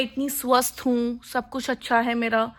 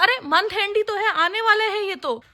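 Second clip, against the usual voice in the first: retold protest; 7 semitones higher than usual. Retelling own reply to friend.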